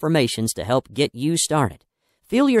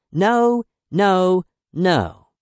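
a hint of a scolding parent, higher and a bit louder; mock parent's no; 5 semitones higher, 4 dB louder, much slower than usual. Author mimicking parents refusing a child, mock scolding. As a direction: toss off the noes like a fed-up parent.

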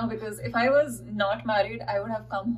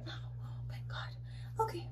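breathy whisper; 12 semitones higher, 15 dB quieter than usual. Real, not acted. Whispered exclamation.

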